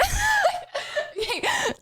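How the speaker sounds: short, high burst of laughter. Real, not acted. Explaining inactive VIPs to chat, she lets out a high laugh. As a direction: cackle at the obvious answer. Laughs at her own logic.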